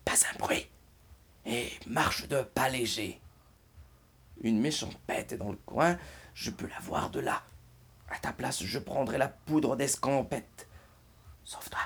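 soft hushed warning, barely voiced; frightened whisper. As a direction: whisper like a scared bear hiding. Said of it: "Chut, fit ce dernier".

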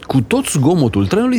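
ordinary delivery

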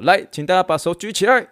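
high, loud stern order; fierce command. Retold or imitated. Military-style head teacher commands the students, fierce shout. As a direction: bark it at the whole classroom. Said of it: "当时很凶这样讲".

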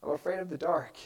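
a mild hint of a child's tearful fear; quiet terrified voice. Child admitting fear of the dark to parent, softly and scared. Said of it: "In a quiet, terrified voice through sobs".

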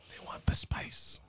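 whispering. Acted. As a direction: whisper it like a secret. Savoring hot sauce, hushed.

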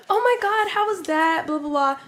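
high, bubbly fake-nice voice. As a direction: gush like a fake-friendly mom. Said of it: "you gave me the fake voice".

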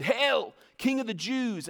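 mock the king with a jeer; mocking taunt. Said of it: "and taunted".

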